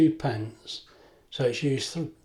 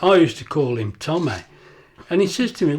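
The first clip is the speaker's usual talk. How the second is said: ordinary talk, nothing marked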